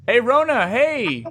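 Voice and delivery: excited greeting. Real, not acted.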